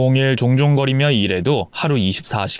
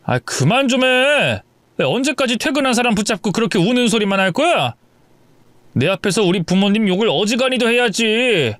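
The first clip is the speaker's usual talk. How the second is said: higher, irritated outburst from the husband; 7 semitones higher than usual. Acted. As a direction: snap at her to stop complaining. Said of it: "기어이 짜증을 내더군요". (퇴근한 남편이 아내에게 짜증 내며 높게 쏘아붙임)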